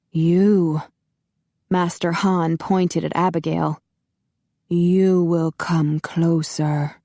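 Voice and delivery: slow, muffled, menacing master; muffled masked villain. A narrator's reading. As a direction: speak slowly behind a mask. Master Han commanding Abigail.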